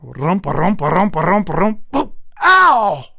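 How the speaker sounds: imitating a man running then falling with a loud ow; running and jumping noise. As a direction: mimic feet pounding then a crash and yelp. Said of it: "It went like this".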